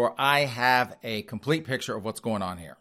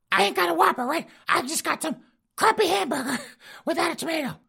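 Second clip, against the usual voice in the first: high squeaky granny lecturing her husband; falsetto old wife ranting. Retold or imitated. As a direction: rant it in a shrill old lady falsetto. Wife ranting about Whopper.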